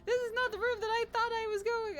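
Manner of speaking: high, loud panic at the wrong room; rising panic; panicked outburst. Real, not acted. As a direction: yelp it out, alarmed. Panicked outburst, much higher, louder.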